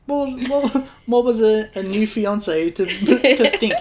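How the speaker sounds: loud, high, laughing protest; stammering through amused outrage; mock indignation. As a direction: plead the case, half laughing.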